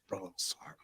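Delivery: soft, low voiceless whisper. Retold or imitated. Dead brother whispering an apology in his ear, hushed. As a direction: breathe it into his ear like a ghost. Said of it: "as a whisper".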